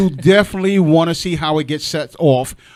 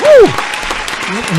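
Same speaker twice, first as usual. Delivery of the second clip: loud high whoop of excitement; hype whoop; 8 semitones higher, 8 dB louder than usual. Real, not acted. Host whooping to get the audience clapping for the group. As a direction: cheer the room on with a big woo. Hyping the crowd at show end.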